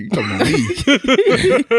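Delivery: high, drawn-out indignant challenge through laughter; mock angry. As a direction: playfully puff up like an offended big man.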